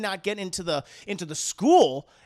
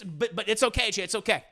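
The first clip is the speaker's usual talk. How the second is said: ordinary delivery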